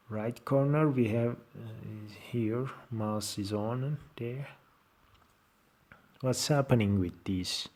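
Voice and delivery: ordinary delivery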